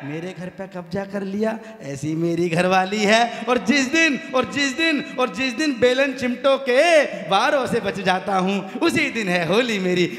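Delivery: slow, emphatic verse delivery; poem recitation. Acted. Poet reciting his comic verse to the audience, slow and emphatic. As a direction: drive the verse home line by line.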